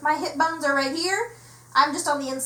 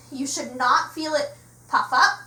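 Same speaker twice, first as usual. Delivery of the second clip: ordinary delivery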